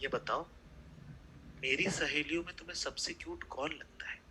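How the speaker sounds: a hint of a sugary girlfriend; mock cooing. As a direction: coo the trap question softly. Girlfriend voiced cooing trap question.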